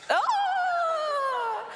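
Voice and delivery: high-pitched startled Oh!; a squeak of fake shock; mock flustered oh. Presenter faking shock at an awkward couple, a high squeal.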